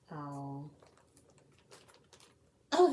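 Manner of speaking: ordinary delivery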